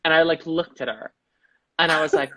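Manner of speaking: usual speaking voice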